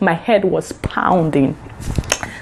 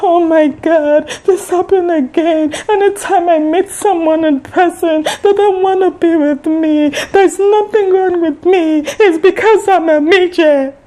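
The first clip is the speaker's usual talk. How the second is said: high, slow, wailing put-on cry; hammed-up tearful whine, much higher; mock sobbing; 9 semitones higher than usual. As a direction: imitate him bawling over being rejected again. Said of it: "Then this guy burst into tears".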